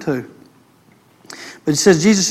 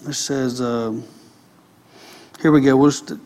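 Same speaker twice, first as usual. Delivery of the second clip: ordinary talk, nothing marked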